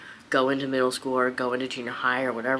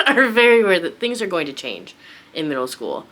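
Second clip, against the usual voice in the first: laughing; 4 semitones higher, 8 dB louder than usual. Real, not acted. Laughing while describing the boys.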